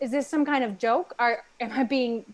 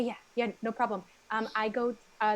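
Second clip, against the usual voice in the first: usual speaking voice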